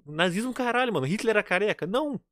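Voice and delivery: xingando alto e agudo; desabafa, furioso com os skinheads; indignado. Real, not acted. (indignação exaltada)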